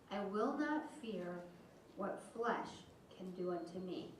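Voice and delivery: slow, soft, reverent Bible reading; scripture reading. Acted. Pastor reading a psalm to the congregation, hushed and slow. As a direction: read the verses quietly and prayerfully. Reads the psalm verses aloud.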